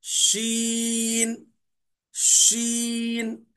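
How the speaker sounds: شین held very long, hiss spreading; spreading shin. Shown as a demonstration. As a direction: stretch شین so the air spreads. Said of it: "مخرج میں پھیل کر نکلتی ہے". (شین کو پھیلا کر ادا کریں)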